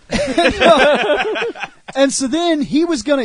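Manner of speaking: ordinary talk, nothing marked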